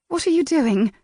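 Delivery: put-on high, alarmed young woman's question; startled. A narrator's reading. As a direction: recoil in surprise. Miss March startled.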